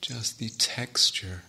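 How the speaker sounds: calm, unhurried meditation guidance with long pauses; settled, spacious meditation-teacher voice; guided meditation. Real, not acted. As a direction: guide the sitters gently, phrase by phrase, leaving long silences. Leads a sitting meditation.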